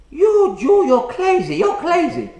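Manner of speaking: put-on loud, high exasperated Chinese-accented outburst; mock Chinese accent. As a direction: dismiss him in a caricatured Chinese accent.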